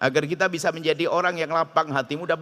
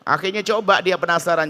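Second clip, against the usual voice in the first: ordinary delivery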